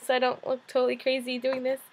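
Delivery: lightly voiced blogger urging everyone to join; imitating Mia. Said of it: "and then she was like".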